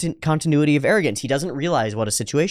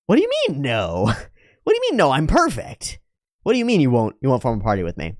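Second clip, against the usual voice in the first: mock disbelief. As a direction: play the vain guy stunned at being refused. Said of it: "he's like".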